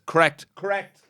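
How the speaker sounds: blurting the answer twice in a panic; nervous repeated answer; panicked double answer. Retold or imitated. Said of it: "I started answering twice".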